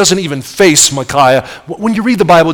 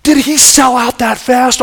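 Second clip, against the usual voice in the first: surprised; 8 semitones higher than usual. Retold or imitated. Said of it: "I thought".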